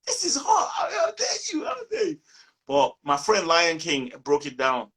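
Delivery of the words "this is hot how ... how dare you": far higher, mock outraged squeal; outraged disbelief. He protests in mock outrage that the song is hot. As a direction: play offended that she dislikes it. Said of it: "I was like".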